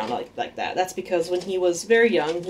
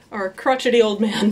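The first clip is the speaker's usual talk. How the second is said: usual speaking voice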